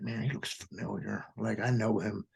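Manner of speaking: quietly puzzling over a face; hushed thought. Retold or imitated. Spotting his late friend's father across the road, a soft private murmur. Inner thought recognizing a man.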